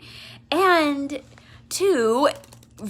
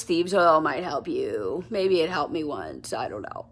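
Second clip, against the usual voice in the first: unenthusiastic; 7 semitones lower than usual. Shown as a demonstration. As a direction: shrug it off like you don't care. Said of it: "my example was an exaggeration".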